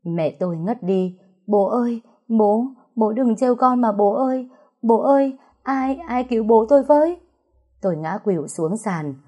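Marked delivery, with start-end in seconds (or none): 1.5-7.2 high, loud, desperate calls that keep climbing; panicked crying out. A narrator's reading. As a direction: beg for help in panic. Daughter finds father hanged.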